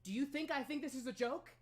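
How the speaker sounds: high, loud, upset rebuke; angry mother. Acted. Queen rebuking her daughter after weeks of fear, loud and high. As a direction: snap back hurt and furious. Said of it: "She goes".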